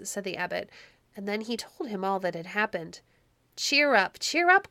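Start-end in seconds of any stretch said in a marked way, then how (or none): 3.6-4.7 high, lively encouragement; cheering up. A narrator's reading. Shepherd to his sorrowful master, cheering him up. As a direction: urge him brightly, lifted and louder.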